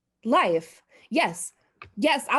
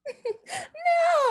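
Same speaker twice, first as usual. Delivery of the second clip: very high, half sob half laugh; hysterical crying laugh. Acted. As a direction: break into a frantic tearful laugh. Hysterical outburst at accusation.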